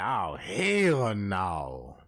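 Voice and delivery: hammed-up low "hell no"; deep disgust. Disgusted reaction to the lumps.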